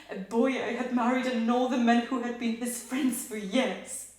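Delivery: loud, agitated dramatic confession; agitated revelation. Acted. Wife revealing whom she found in the room, loud and agitated. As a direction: push out the painful discovery forcefully. Recalls discovering husband with lover.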